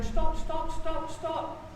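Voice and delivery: high urgent stop, stop, mock shouting; wife shouting to stop the bike, retold shout. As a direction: call the stops out sharply, half-acted. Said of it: "Catalina's shouting".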